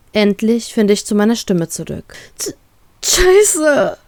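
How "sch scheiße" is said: high, broken sob on the curse; wailing through tears. Acted. Crying girl wails into the phone snail, sobbing. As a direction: choke out the curse mid-cry. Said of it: "jammere ich".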